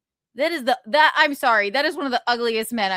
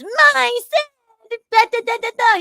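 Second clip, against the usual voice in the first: much higher, louder squeaky mock voice; mocking the lawyer; 14 semitones higher, 6 dB louder than usual. Retelling the lawyer's answer, as a high squeaky mock. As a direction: parrot him in a silly high squeak. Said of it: "And then he goes".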